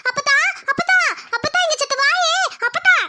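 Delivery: urgent call for help. Acted. Child calling out for grandmother.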